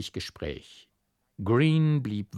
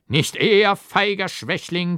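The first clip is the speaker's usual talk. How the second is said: higher, much louder heroic bellow; mock-heroic shout; 9 semitones higher, 8 dB louder than usual. A narrator's reading. Doctor refuses to let go of innkeeper, hammed-up shout. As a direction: roar like a stage hero at a coward.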